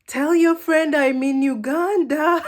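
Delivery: put-on high, loud Ugandan-accented wail; mock cry in a Ugandan accent, imitating sister. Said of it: "when my sister heard this, she said".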